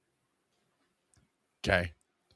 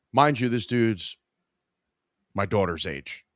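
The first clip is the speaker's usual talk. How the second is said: ordinary delivery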